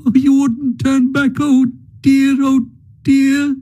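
high, tearful pleading of a grieving mole. Acted. Mole pleading with Ratty about his lost home, tearful and high. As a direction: let the grief spill out in a high, wavering voice. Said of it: "sobs again took full charge of him".

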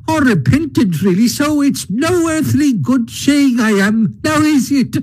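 high, conceited toad voice, flattering; a vain toad buttering up the Badger; boastful Toad. A narrator's reading. Toad's voice in the story.

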